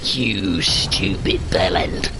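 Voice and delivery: slow whispered insult. Real, not acted. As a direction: breathe the insult out slowly. Insults game character under breath.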